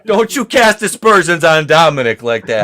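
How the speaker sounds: mock outrage. Mock-offended defence of a song.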